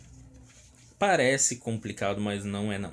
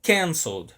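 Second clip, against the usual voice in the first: "Canceled" louder and higher on CAN, then falling; first-syllable stress; 5 semitones higher, 5 dB louder than usual. Shown as a demonstration. Teacher returns to where the consonant doubles, first-syllable stress. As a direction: punch CAN at the start of "Canceled". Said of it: "Ali, onde você dobra".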